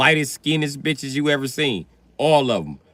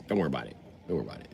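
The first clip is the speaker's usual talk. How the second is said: much softer and lower, trailing away; fading to quiet; 12 semitones lower, 13 dB quieter, much faster than usual. Shown as a demonstration. Telling the crowd softly not to worry. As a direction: let it die off under your breath. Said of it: "Don't worry about it repeated".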